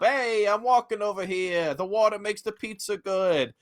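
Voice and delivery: high, put-on New York guy; New York accent. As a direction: do a quick New York impression. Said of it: "of I B D W".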